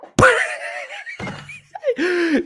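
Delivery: high, loud squealing laugh; real breathless squeal of laughter; squeeing laughter. Real, not acted. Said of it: "squee in laughter".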